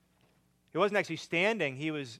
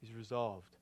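much softer, near whisper; hushed; 5 semitones lower, 10 dB quieter than usual. Real, not acted. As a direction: let it fall to a hush. Hushed repeat of earlier line.

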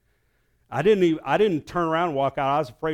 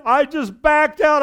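high, loud yes; excited agreement; 12 semitones higher, 8 dB louder, slower than usual. Retold or imitated. Pastor to brother-in-law, high and loud. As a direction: blurt out agreement.

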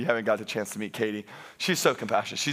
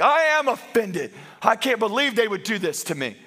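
retold indignation; 8 semitones higher, 3 dB louder than usual. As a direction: play up being offended. Indignant retort in retold quarrel.

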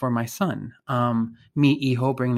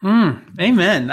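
normal talking voice

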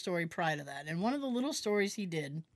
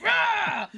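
loud, high mock-angry roar; hammed-up roar of an angry zebra; 12 semitones higher, 8 dB louder than usual. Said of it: "So I just had him angry".

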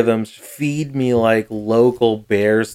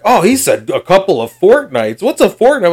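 mock-innocent, pitched up high; sarcastic, playing dumb; sarcastic mockery; 7 semitones higher than usual. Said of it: "had a sarcastic bit about him".

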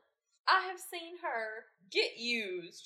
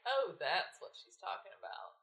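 deflated; 5 semitones lower, 7 dB quieter than usual. Retold or imitated. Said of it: "and I was like".

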